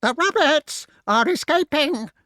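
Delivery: high, loud shriek; shrieking crow. A narrator's reading. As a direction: shriek it in alarm. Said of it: "shrieked the crow".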